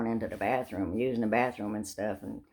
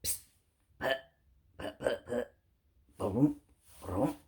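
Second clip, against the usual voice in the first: soft mock engine sputter, puffs then booms; sputtering car noise; 7 dB quieter than usual. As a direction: imitate a dying old Volkswagen coughing out. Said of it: "here goes the old Volkswagen".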